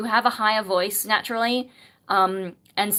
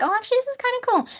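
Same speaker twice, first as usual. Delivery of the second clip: much higher, fast excited thought; pleasantly surprised; much faster than usual. Retold or imitated. Reacting to her own song in false chord, surprised delight. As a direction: gush in sudden delight. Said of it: "then I'm like".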